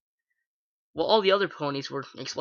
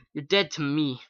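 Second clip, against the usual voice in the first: plain reading voice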